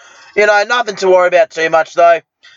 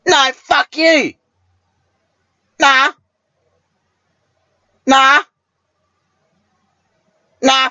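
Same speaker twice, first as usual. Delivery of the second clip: mock phone argument; 6 semitones higher than usual.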